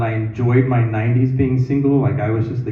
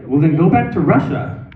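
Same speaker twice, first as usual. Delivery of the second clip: mild mock yell, pitched higher; retold yell; 4 semitones higher than usual. Worker snaps back at a Russian customer, retold as a mild yell. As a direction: re-enact an outburst without really screaming. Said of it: "I, like, screamed it out loud".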